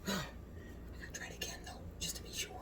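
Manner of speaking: conspiratorial giggling whisper. Real, not acted. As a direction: whisper like sneaking a treat. Sneaking another bite.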